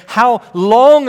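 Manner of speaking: high, loud cry of How long; anguished cry. Retold or imitated. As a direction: cry out to God in frustration. Prophet's complaint voiced.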